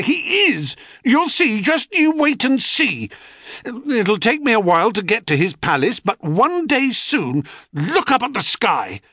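put-on a high, heated boy insisting, pitch soaring; defiant boy. A narrator's reading. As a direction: hurl the promise at the mockers.

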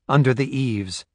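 ordinary delivery

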